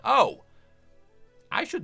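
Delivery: loud, high acted "oh"; acted realization. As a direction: voice his sudden realization, loudly. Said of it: "he's like".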